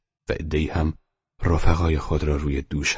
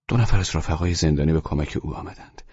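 ordinary delivery, nothing marked

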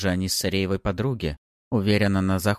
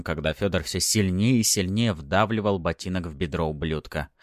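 usual speaking voice

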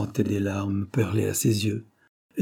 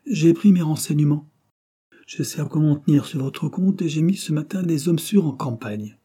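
forceful, higher-pitched declaration; brusque doctor; 5 semitones higher, 5 dB louder than usual. A narrator's reading. Doctor who has made enquiries about Armand, insistent. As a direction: press him with brisk authority. Doctor's speech in narrated dialogue.

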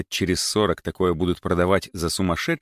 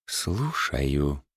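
icy; 5 semitones lower, 5 dB quieter than usual. Acted. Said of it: "голосом столь же ледяным".

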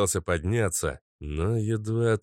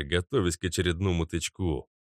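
usual speaking voice

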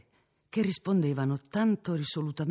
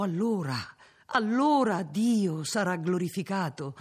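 fervent; 6 semitones higher, 4 dB louder than usual. Acted. Cardinal's fervent climax.

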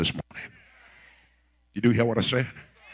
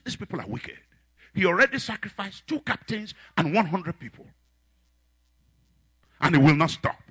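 normal talking voice

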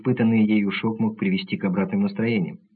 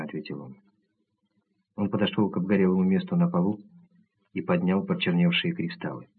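ordinary delivery

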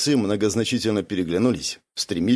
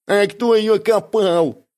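slow, high wail; near tears; 9 semitones higher, 4 dB louder, slower than usual. A narrator's reading. Бяка claiming credit for the pit, near tears. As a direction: whine it slowly as if about to cry. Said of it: "чуть не заплакал Бяка".

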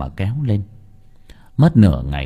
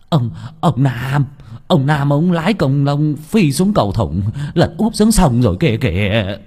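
halting, higher panicked report; breathless stammer; 5 semitones higher, 3 dB louder than usual. Acted. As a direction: trip over the words in fright. Villager reporting the crash, stammering.

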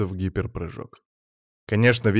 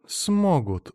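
higher, falling, mildly amused; wry chuckle; 8 semitones higher than usual. A narrator's reading. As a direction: say it with a knowing smirk. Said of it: "усмехнулась Ренада". (с усмешкой)

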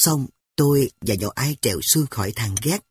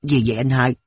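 ordinary delivery

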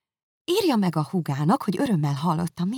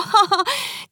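nevetés; 4 dB louder than usual. Acted. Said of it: "kiáltotta Miss Bingley".

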